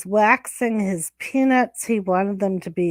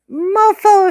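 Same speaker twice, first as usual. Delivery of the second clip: loud mofo; 10 semitones higher, 7 dB louder than usual. Acted.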